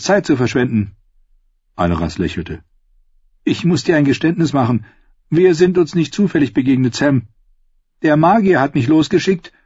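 a calm confession, voice lifted for her; Aleras voiced.

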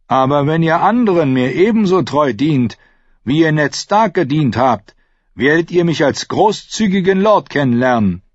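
loud, high-pitched lordly declaration; self-important prince making a speech; proud Theon proclaiming. Acted. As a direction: proclaim your claim to a crowded hall. Theon addresses the others.

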